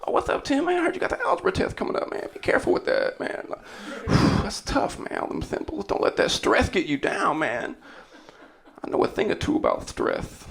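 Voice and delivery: soft, fast mock pep talk in the hallway; imitating the coach. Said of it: "He's like".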